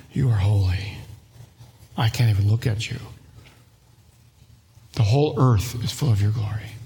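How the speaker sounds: reverent awe. Retold or imitated. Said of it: "They say".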